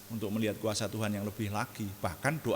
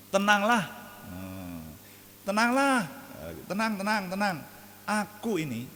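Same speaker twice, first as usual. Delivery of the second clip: reassuring call; 10 semitones higher, 4 dB louder than usual. Retold or imitated. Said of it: "tadi dikatakan".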